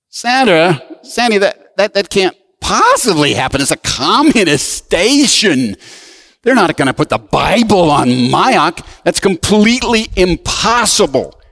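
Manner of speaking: high-pitched, stammering mock protest; acted disbelief. Retelling his disbelief to Sandra.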